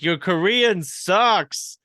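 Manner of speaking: slow, high, loud taunt; mocking heckler. Guy heckles the comedian's Korean, slow high taunt. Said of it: "He was like".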